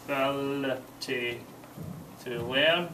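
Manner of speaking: slow northern English Ability to learn with a rise on learn; slow dictation. Shown as a demonstration. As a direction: say Ability to learn slowly for note-taking. Teacher dictates answer slowly.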